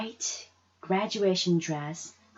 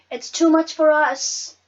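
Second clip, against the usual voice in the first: firm mother; 9 semitones higher, 9 dB louder than usual. Acted. Mama refusing, loud high.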